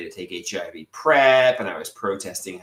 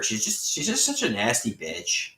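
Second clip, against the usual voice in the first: ordinary talk, nothing marked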